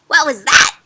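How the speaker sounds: put-on loud startled cry from a baby bird; cried out. A narrator's reading. Baby bird cries out at the strange bat. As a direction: call it out, startled. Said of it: "cried Flap".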